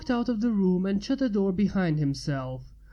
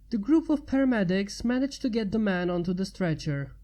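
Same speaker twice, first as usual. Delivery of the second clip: ordinary delivery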